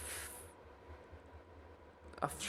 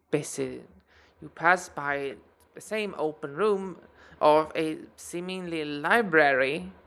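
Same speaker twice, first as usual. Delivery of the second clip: ordinary talk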